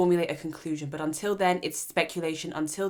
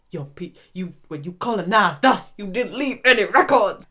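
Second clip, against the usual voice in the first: louder, pointed mock accusation; mock accusing; 7 dB louder than usual. Host re-voicing the visitor accusing the lady, louder. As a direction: throw the colonial jab back, louder.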